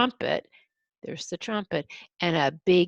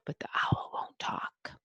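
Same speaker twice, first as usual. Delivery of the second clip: hushed storyteller whisper; 7 dB quieter than usual. Acted. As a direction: breathe it, no voice at all.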